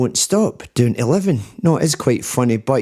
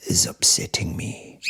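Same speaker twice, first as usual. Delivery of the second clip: whisper; 6 semitones lower, 7 dB quieter than usual. Real, not acted. Complaining about son, whispered.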